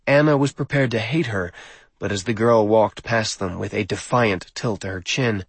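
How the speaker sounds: ordinary delivery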